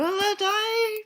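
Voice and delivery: high, whiny mock cry; mock whine. Real, not acted. Tired reader whining to the audience, high mock cry. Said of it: "so I'm a little tired".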